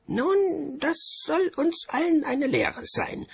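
very high, put-on official voice; high-pitched bursar making a declaration; bursar character. A narrator's reading. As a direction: pronounce the moral in a high, loud voice.

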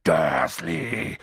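low, drawn-out growl of a giant; booming. A narrator's reading. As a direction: growl the name as an accusation. Hagrid booms the name again.

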